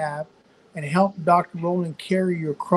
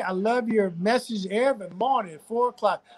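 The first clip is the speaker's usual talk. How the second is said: ordinary delivery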